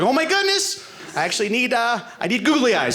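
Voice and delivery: high, squealing mock excitement; excited crafter. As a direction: gush like a thrilled crafter. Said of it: "like, like".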